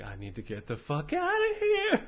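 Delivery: hammed-up sudden panic; panicked patient. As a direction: imitate a man waking up terrified. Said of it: "and he's like".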